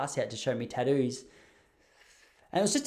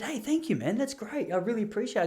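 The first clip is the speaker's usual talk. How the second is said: mock chirpy reply; 9 semitones higher than usual. Teacher's overly chirpy reply.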